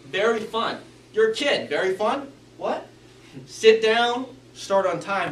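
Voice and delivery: very fun and sit down stressed loud; emphatic demo of key words; punching key words. Shown as a demonstration. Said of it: "He emphasized key words".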